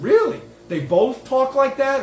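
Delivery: amazed question about the Kennedys' voices; startled disbelief. Retold or imitated. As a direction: retell your own startled double take.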